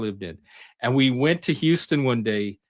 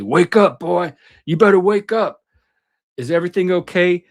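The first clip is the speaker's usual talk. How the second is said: raised, loud wake-up order; higher, louder voice of an insistent officer; imitated cop; 6 semitones higher, 6 dB louder than usual. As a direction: imitate a cop rousing a sleeper. Imitates cop kicking him awake.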